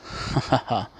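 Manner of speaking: a short, scornful laugh at Pops; spelled-out mocking ha ha ha. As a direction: laugh him off, half amused. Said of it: "The man replies".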